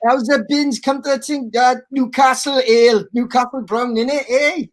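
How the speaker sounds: high, hammed-up Geordie patter; Geordie accent. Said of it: "Geordie, please".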